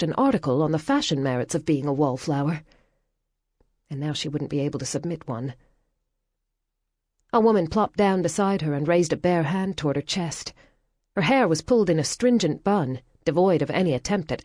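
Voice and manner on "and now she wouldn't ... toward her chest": ordinary delivery, nothing marked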